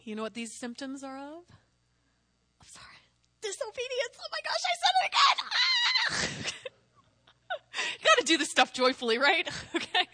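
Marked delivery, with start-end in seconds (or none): 3.4-5.4 very high squeal, speeding up into a shout; mock horror. Mock horror at her own word. 5.5-6.5 very high-pitched playful shriek; mock horrified scream. Mock scream at her own word.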